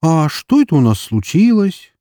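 high, chirpy mock movie voice; hammed-up cheerful waking hero; movie cliché wake-up. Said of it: "и такой".

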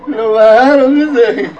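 bursting into high laughter mid-story; helpless, high-pitched laughing delivery; real laughter. Real, not acted. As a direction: laugh loudly through the words.

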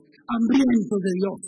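hambrientos, lento y fuerte; énfasis de predicador. Real, not acted. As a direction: remarca cada sílaba de hambrientos. Predicador enfatiza. (hambrientos dicho lento y con fuerza)

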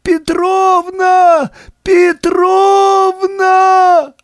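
high, slow desperate cry; piercing shout. A narrator's reading. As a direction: wail her name across the ward. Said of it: "пронзительно закричал".

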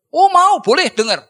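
high, loud eager reply; eager agreement. Retold or imitated. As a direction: jump at the offer to hear it.

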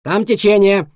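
loud, sharp alarm call; barked warning. Acted. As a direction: bark the warning out. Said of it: "гавкнул пёс".

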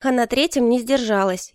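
ordinary delivery

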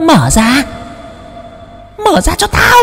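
high, screeching threat from a ghoul; shrieking demon. Acted. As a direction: screech at him to open up. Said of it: "mà rít lên". (hồn ma rít lên đòi mở cửa, giọng the thé)

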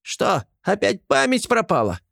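high, wailing squeak; howling gremlin. Acted. Gremlin wailing at Artyom's forgetfulness, high howl. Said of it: "взвыл коротышка".

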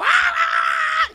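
sudden high yelp of fright; scream of fright. Retold or imitated. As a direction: shriek as the dog jumps out. Fierce dog frightens him.

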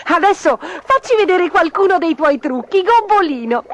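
high, loud and lively; animated storybook character, pitched high; eager challenge. A narrator's reading. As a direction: call out a showy challenge. Character challenges Gobbolino to show tricks.

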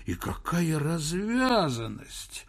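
slow, high indignant exclamation; upsetting himself. A narrator's reading. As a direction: work yourself up over someone's cheek. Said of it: "расстраивал сам себя Персиков".

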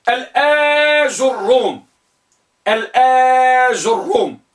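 الآج الروم with a long held آ, slow; lengthened hamza. Shown as a demonstration. Teacher dictating the fourth type, slow long vowel. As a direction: hold the آ in الآج الروم, then ju and doubled ر. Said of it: "بفتح الهمزة مع مد".

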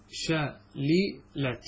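"شللت" drawn out very slowly as shalilat, i under the first lam; slow spelled-out form. Shown as a demonstration. Teacher to students, "شللت" spelled out very slowly with kasra. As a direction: stretch "شللت" into shalilat, syllable by syllable. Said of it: "شللت بكسر العين".

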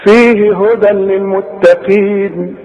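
slow measured chant with long held vowels; Quran recitation. Shown as a demonstration. Teacher replays what was read for students, Quranic chanting. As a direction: recite slowly, drawing out each verse ending. نسمع بقى اللي قريناه.

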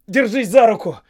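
high, loud rescue command; urgent shout. A narrator's reading. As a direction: call out to someone drowning. Rescue from the water.

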